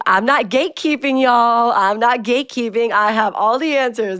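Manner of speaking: playfully loud, high denial; joking protest. Pretend. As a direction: protest too much, in jest. Jokingly protests, then says kidding.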